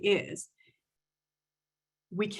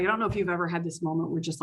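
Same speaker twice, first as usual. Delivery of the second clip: usual speaking voice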